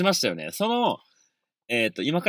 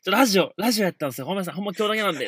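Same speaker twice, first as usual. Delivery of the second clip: quick, flustered excuse; flustered rushed excuse to the neighbour; hurried excuse; 4 semitones higher, much faster than usual. Retold or imitated. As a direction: rush the apology out fast. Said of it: "っつって".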